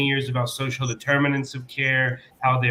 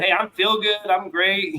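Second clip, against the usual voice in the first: faked cheerful patient; 7 semitones higher than usual. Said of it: "trying to tell doc".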